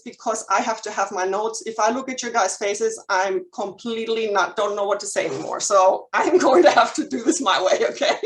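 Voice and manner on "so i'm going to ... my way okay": laughing through the words, louder; a nervous giggle breaking into the line. Real, not acted. Nervous speaker admits improvising.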